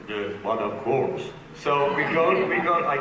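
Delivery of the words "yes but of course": Andre's voice. Retold or imitated. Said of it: "Andre said".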